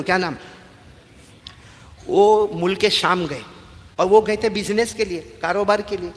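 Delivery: ordinary talk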